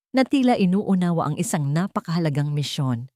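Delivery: ordinary delivery, nothing marked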